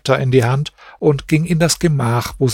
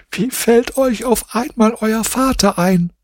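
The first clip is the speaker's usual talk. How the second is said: higher, tearful woman's voice; weeping harder; 10 semitones higher than usual. A narrator's reading. Weeping wife asks her children, higher tearful voice. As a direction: ask through growing tears. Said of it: "weinte noch heftiger und sagte".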